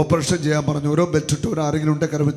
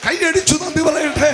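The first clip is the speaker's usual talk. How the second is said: preacher's shout; 10 semitones higher, 6 dB louder than usual. Acted. Calls crowd to clap thanks.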